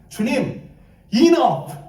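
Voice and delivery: high, exasperated cry to the Lord; fed-up plea. Acted. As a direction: burst out that you have had it. Acts out Elijah's weary prayer.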